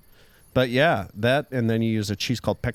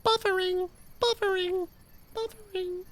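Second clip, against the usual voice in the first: very high monotone "buffering" on repeat; mock loading voice; 4 dB quieter than usual. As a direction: play a stuck computer in a high, unchanging pitch. Filling time while other searches.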